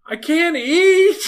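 slow, high-pitched plea; slow high whine. Retold or imitated. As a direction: drag it out, pitiful and high. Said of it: "I'm like".

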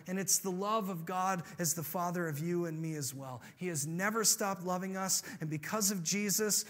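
ordinary talk, nothing marked

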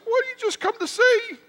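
very high, lightly mocking wife's voice. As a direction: play the wife teasing him. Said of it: "my wife's like".

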